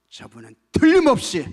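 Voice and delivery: sudden loud, high burst of certainty; emphatic conviction. Acted. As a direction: proclaim absolute faith at full voice. Woman's certain faith, acted.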